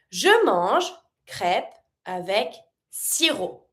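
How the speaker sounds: slow, careful French reading; slow model reading of Je mange crêpe avec sirop. Shown as a demonstration. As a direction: read each word slowly in French. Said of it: "Don't pronounce the P".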